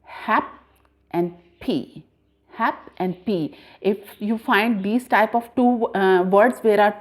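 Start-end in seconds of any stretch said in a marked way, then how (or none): 0.0-2.0 HAP, pause, and P as two separate units; split into syllables. Shown as a demonstration. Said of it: "they are giving the sound". 2.5-3.6 slow HAP and P, each part distinct; split into syllables. Shown as a demonstration. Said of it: "they are giving the sound".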